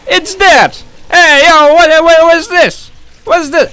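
loud, high New York Italian tough guy; Italian New Yorker. Acted. Gamer slipping into Italian New Yorker voice, loud and high. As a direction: play a loud Brooklyn wise guy. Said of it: "I became an Italian, New Yorker".

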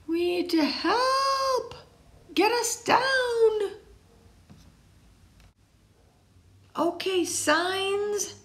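put-on high childlike character voices; high excited kid voices in a story. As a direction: play the kids and sign kids eagerly. Signs call out.

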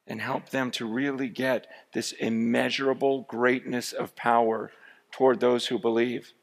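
soft, earnest spoken prayer. Acted. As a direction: pray quietly over the people. Prays over the congregation.

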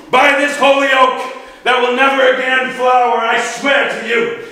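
high, loud sworn oath building to a shout; furious rage. Acted. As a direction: thunder a vow of fury, climbing to a full roar. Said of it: "he flies into a rage".

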